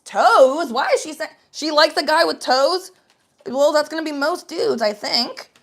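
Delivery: loud confused surprise; baffled loud questioning; confused outrage. Retold or imitated. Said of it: "I said".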